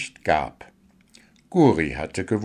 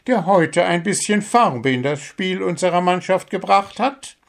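mocking bird coach; 12 semitones higher than usual. Coach bird mocking the captain. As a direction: needle the captain with a mock compliment. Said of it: "spottete der Vogel".

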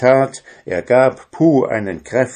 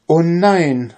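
high, loud cry of dismay; horrified cry; 8 semitones higher, 6 dB louder than usual. A narrator's reading. Dwarf finds the magic mirror blank, horrified cry. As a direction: call out aghast. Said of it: "rief der Zwerg entsetzt".

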